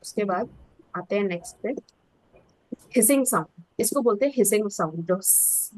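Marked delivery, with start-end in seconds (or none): 5.2-5.7 a soft unvoiced hiss of s; hissing s demo. Shown as a demonstration. Teacher showing students the hissing sound, soft hiss. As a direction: let the s hiss out quietly. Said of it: "ऐसे ऐसे करते हैं ना".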